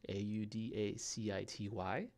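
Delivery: usual speaking voice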